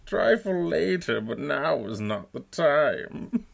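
loud, slow, muffled villain threat; menacing, spoken into a cup; Bane voice. Shown as a demonstration. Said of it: "Bane has never sounded so good".

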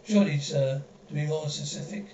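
normal talking voice

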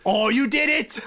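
high, loud mock gotcha; mock gotcha cry. Friend mocking a wrong Long Island usage, high loud gotcha. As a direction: crow like catching someone slipping up. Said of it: "It just".

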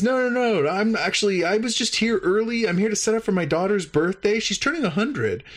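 high, chatty alien voice; friendly alien. Retold or imitated. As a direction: pitch up, casually explaining. Judge alien explains.

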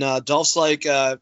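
normal talking voice

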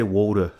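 "water" said as "wooder"; Philadelphia pronunciation. Shown as a demonstration. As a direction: say "water" the Philadelphia way, "WOO-der". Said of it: "They water in Philadelphia".

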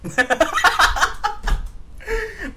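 real high-pitched burst of laughter. Real, not acted. Host cracks up at guest's blunt no, laughing.

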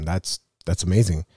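ordinary delivery

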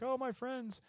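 high, soft, touched cry of affection; lightly imitated happy-crying voice; mock tearful. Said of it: "and she's crying".

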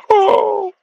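high-pitched amazed "oh"; astonished. Retold or imitated. Shocked at coach's generous announcement.

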